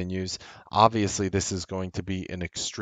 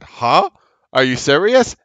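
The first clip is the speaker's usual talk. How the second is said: higher, louder incredulous "huh, are you serious"; stunned disbelief; 6 semitones higher, 8 dB louder than usual. Retold or imitated. Investor reacting to Apple's statement, loud and disbelieving. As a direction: throw it out like you can't believe your ears. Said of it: "And I said".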